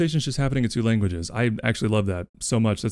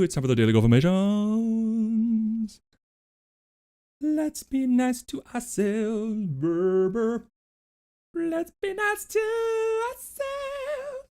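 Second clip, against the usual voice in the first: high sing-song host; 10 semitones higher, 4 dB quieter, slower than usual. Pretend. Opening the daily affirmations segment.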